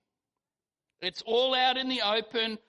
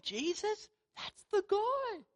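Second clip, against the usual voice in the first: put-on hushed parent to child; 11 semitones higher, 5 dB quieter than usual. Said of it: "hear the parents saying to their kids".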